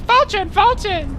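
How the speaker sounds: high, slowed squeaky creature calling its name; Pokemon cry. Acted. Friend mocking the onomatopoeia idea, high Pokemon cry. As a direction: chirp it like a Pokemon saying its own name. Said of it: "go around and go like".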